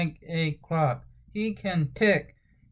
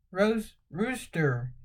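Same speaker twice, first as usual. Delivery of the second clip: usual speaking voice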